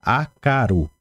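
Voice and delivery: "she akaru, ndere karu, rae okaru" drawn out slowly; teacher repeating the conjugation for the class to copy; each phrase stretched and clearly separated; slow repetition drill. Shown as a demonstration.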